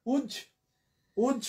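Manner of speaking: উজ as one isolated syllable, with a pause after. Shown as a demonstration. Teacher breaking a word for students, syllable by syllable. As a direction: say উজ alone, cut off from the rest of the word. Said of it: "ওই যে syllable ধরে ভাঙলাম".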